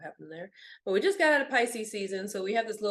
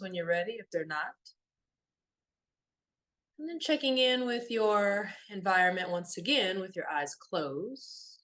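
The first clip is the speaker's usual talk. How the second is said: softer meditation voice with long pauses; guided meditation; 3 dB quieter than usual. Real, not acted. Guide leading listeners through a body scan, calm meditation voice. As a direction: guide them gently inward, leaving long silences.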